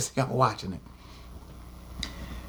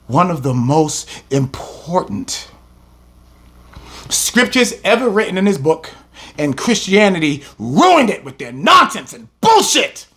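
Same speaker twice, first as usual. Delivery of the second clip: ordinary talk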